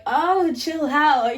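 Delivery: slow, drawn-out chill out; turning down the boyfriend's kiss, teasing; brush-off. Retold or imitated. Rejecting his kiss.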